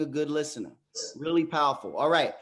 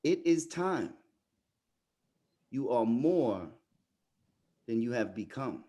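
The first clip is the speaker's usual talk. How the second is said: solemn quiet; 6 semitones lower, 4 dB quieter than usual. Real, not acted. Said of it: "repeats the line solemnly".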